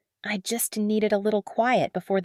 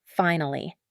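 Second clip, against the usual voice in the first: ordinary delivery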